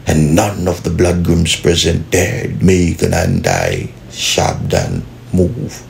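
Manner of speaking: soft, measured English storytelling; story narration. A narrator's reading. Narrator recounting the Don's mercy, soft measured storytelling. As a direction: tell it slowly like a dramatic tale.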